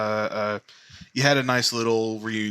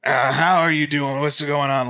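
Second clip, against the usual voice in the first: loud, raised, jovial voice; hearty grandfather greeting; 7 semitones higher, 6 dB louder than usual. Acted. Grandfather fussing over granddaughter, hugging.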